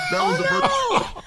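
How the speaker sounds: laughing outburst. Real, not acted. Bursting out at the joke.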